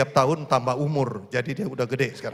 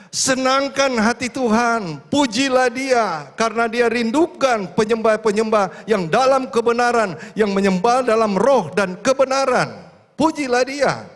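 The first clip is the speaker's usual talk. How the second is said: loud, high, slowed exhortation; fiery preacher's call to worship; preaching at full force; 9 semitones higher, 7 dB louder than usual. Acted. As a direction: urge the congregation to praise, ringing out.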